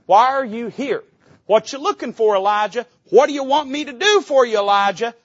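pleading God asking Elijah; God's question. Acted. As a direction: press him with the question. Preacher voicing God's question.

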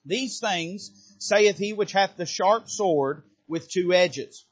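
slow, measured reading of scripture. Acted. Pastor reading Revelation to congregation, measured and solemn. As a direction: read the Bible passage solemnly.

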